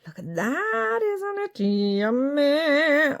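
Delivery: high, drawn-out cooing; gushing delight. Real, not acted. Crafter admiring torn vintage map paper, high gushing delight. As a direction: coo over it in a high, stretched voice.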